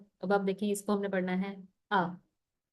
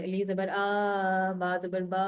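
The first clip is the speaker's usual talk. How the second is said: slow, drawn-out chanting spelling; sing-song school recitation; much slower than usual. Retold or imitated. Teacher imitating schoolchildren, slow sing-song. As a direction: drag it out like kids reciting in class. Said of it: "वो मैं अक्सर सुनती होती थी, school वगैरह में बच्चे पढ़ते थे".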